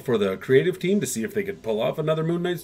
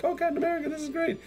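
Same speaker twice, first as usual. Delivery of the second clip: much higher, breathy-free falsetto gush, softer; swooning fan; 5 dB quieter, faster than usual. Retold or imitated. Diner crowd swoons over Cap.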